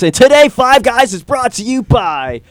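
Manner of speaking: radio sponsor-read announcer. Shown as a demonstration. Host showing off a station ad read, radio voice. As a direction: sell it like a radio sponsor spot. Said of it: "I've got the radio voice".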